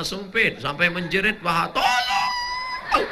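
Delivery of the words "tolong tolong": much higher cry for help; shrieking girl. Acted. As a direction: squeal a mock call for help. Perempuan menjerit karena celana sempit. (jeritan minta tolong bercanda)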